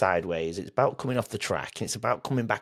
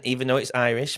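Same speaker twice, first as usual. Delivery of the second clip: usual speaking voice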